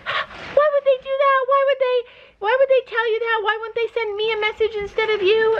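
squeaky high mock voice for the husband. Wife mimicking her husband's complaint in a high squeaky voice. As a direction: play him as a whiny, indignant squeak.

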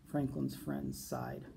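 usual speaking voice

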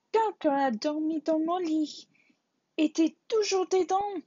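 high, loud little bear shout; Baby Bear shouting. A narrator's reading. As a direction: yell it loud enough to wake Goldilocks. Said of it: "cria Bébé Ours si fort". (Bébé Ours crie si fort que Boucle d'Or se réveille)

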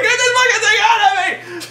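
very high, loud mock shriek; hammed-up screaming. Pregnant scientist begging the robot, hammed-up screaming. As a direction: shriek it in exaggerated panic. Retold woman with alien inside.

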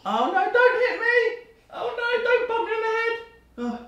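high, slow mock panic; playful fake fear, laughing; mock scared. Child hitting dad playfully.

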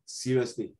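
normal talking voice

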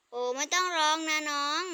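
ordinary delivery

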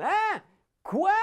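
high, loud shout over machines; shouting over noise. Shown as a demonstration. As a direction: yell it over the din. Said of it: "devoir crier pour vous faire entendre".

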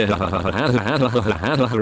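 breathy mock villain cackle; hammed-up wicked laugh; evil cackle. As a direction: cackle like a gleeful cartoon villain. Cackling after his own joke.

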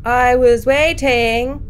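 slow ominous I was waiting; creepy voice. Pretend. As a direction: read it like the lurking thing. Spooky game voice reading line.

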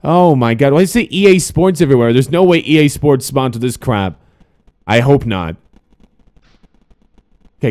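usual speaking voice

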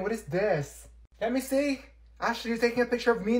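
usual speaking voice

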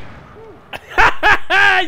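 loud mocking cackle; gleeful laugh. Real, not acted. Laughing at enemy.

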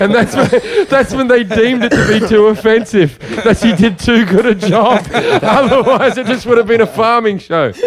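high, helpless laughter through words; laughing. Real, not acted. Comic explaining why the show got banned, high laughing delivery. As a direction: crack up while delivering the punchline.

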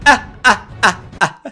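clumsy hammed-up villain cackle; bad attempt at a sinister laugh; evil laugh. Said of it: "Cada vez me sale peor la risa".

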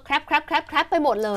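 short clipped Thai-style crab, repeated quickly; Thai-accented crab. Shown as a demonstration. Teacher showing how Thais say crab, short Thai-style pronunciation. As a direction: CRAB cut short with an unvoiced final stop. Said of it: "พอเราออกเสียงแบบไทยๆ".

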